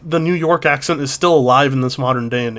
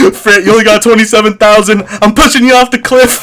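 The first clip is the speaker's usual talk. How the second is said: loud, higher-pitched tirade; mock threat; 5 semitones higher, 5 dB louder than usual. Streamer threatening Fred over a small following, in mock menace. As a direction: shout a joking threat.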